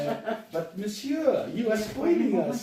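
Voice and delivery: a hammed-up French waiter, slow and laughing; mock French accent. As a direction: play a cheesy Frenchman flattering the guests. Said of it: "I speak in French right now".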